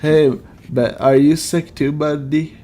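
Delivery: normal talking voice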